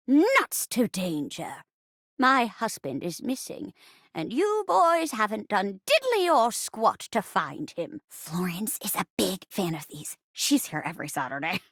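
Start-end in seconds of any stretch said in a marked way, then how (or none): 0.1-8.0 put-on feisty old granny. Old wife scolds detective over missing husband, feisty granny voice. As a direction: play a defiant old wife. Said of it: "I'll be playing the part of the poor old rocking chair".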